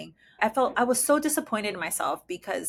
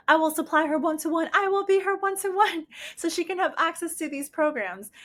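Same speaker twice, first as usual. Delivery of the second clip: eager offer; 7 semitones higher than usual. Retold or imitated. Retold eager offer to agency.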